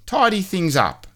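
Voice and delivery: high, prim chirp; brisk and eager to help; Mister Tidy voice. A narrator's reading. Mister Tidy offers to tidy up, high and chirpy.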